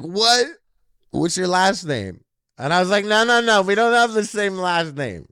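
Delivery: high, loud stunned disbelief; high surprised shout. Retold or imitated. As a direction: blurt it out, shocked at the coincidence. Shocked exchange over shared name.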